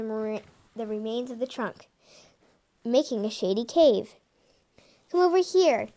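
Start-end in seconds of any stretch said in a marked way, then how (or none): 5.1-5.9 high, loud excited squeal; squealing call. A narrator's reading. Squealing kit calling littermates over, high and loud. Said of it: "Nutcat squealed".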